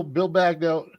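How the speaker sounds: ordinary talk, nothing marked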